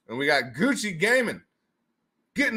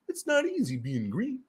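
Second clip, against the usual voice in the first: higher, softer froggy voice; sing-say it like Kermit; Kermit impression; 6 semitones higher, 5 dB quieter than usual. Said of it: "Kermit the Frog here saying".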